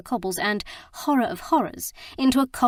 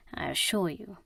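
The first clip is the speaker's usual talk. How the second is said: softer, lower confiding assurance; low aside; 3 semitones lower, 7 dB quieter than usual. Acted. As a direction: murmur it to the blonde. Faris assures the blonde.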